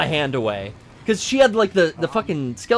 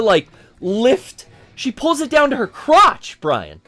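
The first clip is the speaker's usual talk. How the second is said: normal talking voice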